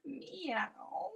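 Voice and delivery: very high, soft meow; a dainty kitten meow. Pretend. Teacher leading cat stretches, very high soft meow. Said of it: "pretend to be a cat".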